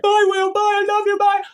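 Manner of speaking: hammed-up lovesick goodbye; mock Robin goodbye. Robin saying goodbye to Will, mocking high sappy farewell. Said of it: "and he's like".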